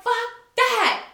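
high, defiant retort; pissed off. Retold or imitated. Woman refuses boyfriend's plea to calm down, pissed off. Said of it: "And I'm just pissed".

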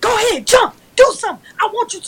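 high, loud mock dare; imitated yelling mom. Mom daring her child to fight, imitated yelling. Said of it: "really yelling".

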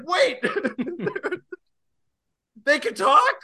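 mock disbelief, voice jumping up; hammed-up surprise; shocked. Shocked realising whales talk.

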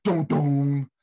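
loud imitated TV drama sting; Law and Order sting. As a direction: punch out two dramatic notes. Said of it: "Law and Order credits".

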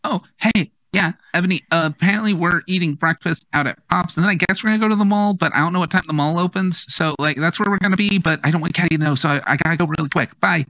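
high, rushed whispery phone call; squealing baby talk aunt. Acted. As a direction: rattle it off, high and hurried.

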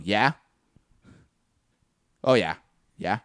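offhand, spaced-out listener responses; uninterested. Shown as a demonstration. Listener answering a heartfelt confession, sounding uninterested. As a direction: say yeah as if not caring. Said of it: "sounds like I don't give a fuck".

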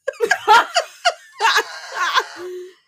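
loud high cackling laugh; helpless high laugh. Real, not acted. Sister remembering her pranks, loud high laughter. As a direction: crack up at your own story.

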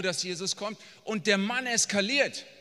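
ordinary delivery

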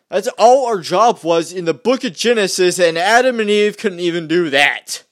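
loud preachy emphasis; fired-up loud sermonizing; heated rant. Real, not acted. Ranting about Genesis, then apologizes.

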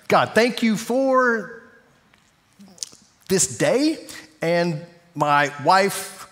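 slow, drawn-out mock prayer; distracted prayer. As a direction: stretch the words as your thoughts wander. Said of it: "so easy to get distracted".

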